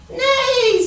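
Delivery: high, loud horse whinny; squealing pony neigh. Acted. Horse voiced in story.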